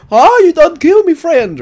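imitating Rocky's Philly accent at full volume; mock Rocky accent. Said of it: "In that accent".

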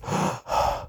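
a sharp audible inhaled gasp; mock deep breath to show the technique. Shown as a demonstration. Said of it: "people take deep breaths".

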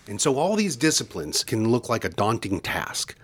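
ordinary talk, nothing marked

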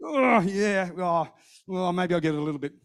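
low, reluctant giving-in; mock half-hearted consent; grudging agreement. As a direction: groan and grudgingly go along. Grudging reply to wife's urging.

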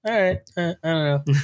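a lightly mocked unconvinced audience; unsure audience. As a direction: voice the hesitant audience. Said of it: "audience is gonna be like".

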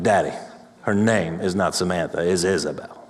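put-on sassy five-year-old. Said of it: "a little sassy five-year-old".

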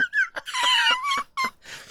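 high squeaky giggle; awkward high-pitched laughter; uncomfortable laugh. Real, not acted. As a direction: burst into an embarrassed giggle. Uncomfortable laugh after odd remark.

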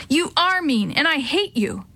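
high-pitched yell without added volume; upset yell. A narrator's reading. Maria lashing out at her brother, upset yell. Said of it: "Maria yelled".

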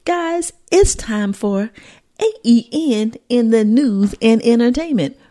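slow, drawn-out show intro voice; segment announcement. Acted.

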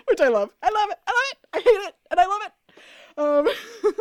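high, loud, laughing fan squeals; giddy gushing. Real, not acted. Fan squealing over Damon's cute PJs line, giddy and loud. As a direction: squeal over the scene you adore.

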